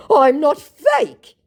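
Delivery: very high, loud, slow whine; mock scammer voice. Mocking scammer denying to her, very high and slow. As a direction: drag out the denial like a sulky liar. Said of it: "he said".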